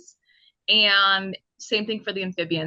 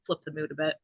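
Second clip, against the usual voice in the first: ordinary delivery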